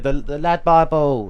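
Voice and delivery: London accent impression. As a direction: echo it loudly in a London accent. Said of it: "No, that was, no, that was good".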